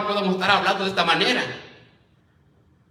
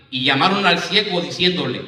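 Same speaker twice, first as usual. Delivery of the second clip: ordinary talk, nothing marked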